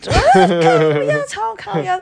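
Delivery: half-laughed, pitch jumping up on the last bit; giggling fake grumble at the old man; laughing mock complaint. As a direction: laugh through a mock-grumpy retort.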